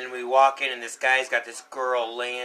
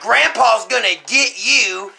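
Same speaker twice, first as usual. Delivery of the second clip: slow, loud, raised taunting voice; imitated menacing threat; 7 semitones higher, 8 dB louder, slower than usual. Storyteller as the guy scaring him, slow loud threat. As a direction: draw it out to frighten a kid. Scare actor threatens the child.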